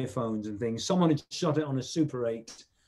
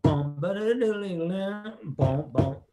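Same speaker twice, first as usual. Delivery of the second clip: higher vocal drum roll, bouncy; drum fill imitation; 4 semitones higher than usual. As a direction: scat the drum fill quickly. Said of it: "And he goes".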